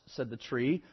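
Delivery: usual speaking voice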